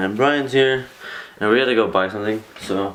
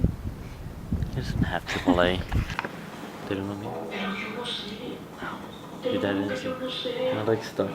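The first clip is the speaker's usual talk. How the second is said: whispering on the phone; 13 semitones higher, 8 dB quieter, slower than usual. Real, not acted. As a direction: keep it down, barely voiced. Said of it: "Why are you whispering?".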